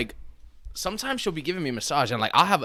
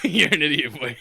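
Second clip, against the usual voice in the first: laughing; 3 semitones higher than usual. Real, not acted. Laughing at friend.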